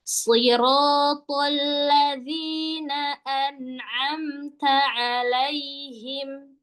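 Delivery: slow, sustained tartil; drawn-out model recitation; Quranic recitation. Shown as a demonstration. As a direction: chant slowly and let the ending fade. Teacher demonstrates slow recitation.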